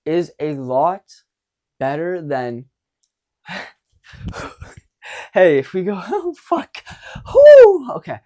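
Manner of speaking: usual speaking voice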